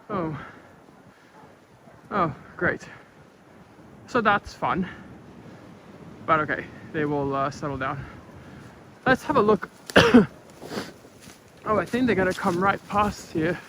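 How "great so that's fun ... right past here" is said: normal talking voice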